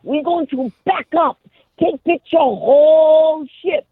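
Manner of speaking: high, eager imitation in a Japanese accent, slowing at the end; mock Japanese accent. Woman proposing to back up the sub, mock Japanese accent. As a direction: pitch up her bright idea in a Japanese accent. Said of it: "She goes".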